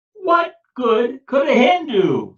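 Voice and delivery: high, loud, slow exasperated question; Peter exasperated. Acted. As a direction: plead in disbelief. Peter questions wise man.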